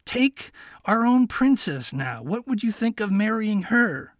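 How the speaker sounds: lightly voiced fox tempting him; sly suggestion. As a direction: fox slyly offering the princess. Fox proposes the princess.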